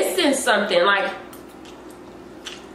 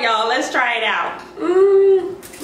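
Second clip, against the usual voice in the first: ordinary talk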